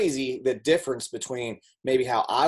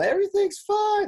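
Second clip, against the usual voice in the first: much higher put-on bright voice with a forced smile; fake cheerful. Stuffer family masking trouble with a smile, falsely cheerful and high. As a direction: sing it out like nothing's wrong. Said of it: "you just put on a smile".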